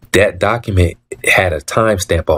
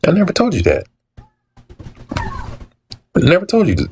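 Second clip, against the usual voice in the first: indignant; 12 semitones higher than usual. Retold or imitated. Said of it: "No, man".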